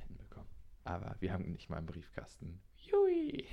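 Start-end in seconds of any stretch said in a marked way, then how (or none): 2.8-3.5 high delighted squeal; excited squeal. Real, not acted. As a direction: squeal with glee.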